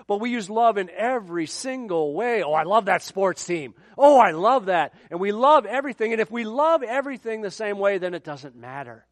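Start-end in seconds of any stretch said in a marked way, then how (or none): 2.4-4.9 loud, exaggerated enthusiasm; mock gushing. Preacher mimicking casual use of love, gushing. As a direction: gush as if it were the greatest thing.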